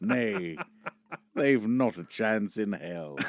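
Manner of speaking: hammy stage actor. Acted. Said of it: "is that [name] or Shakespeare".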